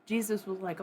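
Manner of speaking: normal talking voice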